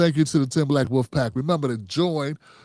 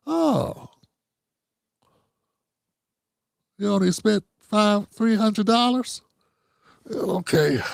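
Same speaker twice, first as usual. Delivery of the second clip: mock surprise; 6 semitones higher, slower than usual. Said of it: "You see this guy?".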